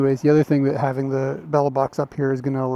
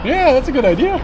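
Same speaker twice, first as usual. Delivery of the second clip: excited; 11 semitones higher, 4 dB louder than usual. Real, not acted. Still excited.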